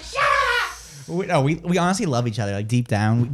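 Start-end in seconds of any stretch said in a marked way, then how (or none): 0.0-1.1 very high imitated shriek; mock screaming co-host. Host imitating co-host's comeback, mock shriek. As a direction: squeal it as her comeback. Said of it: "She's like".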